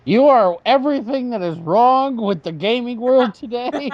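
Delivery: theatrical high-pitched condemnation; mock solemn curse. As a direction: pronounce a hammed-up curse on a friend.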